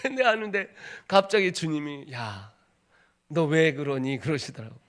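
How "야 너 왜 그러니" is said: voice of God. Retold or imitated. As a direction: speak down as God, gently. God's voice in the story. (낮고 부드러운 하나님 목소리)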